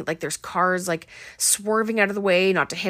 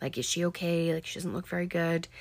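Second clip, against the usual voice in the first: softer, lightly imitated whispering; hushed gossip; 6 dB quieter than usual. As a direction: murmur it like concerned friends gossiping. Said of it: "stop whispering".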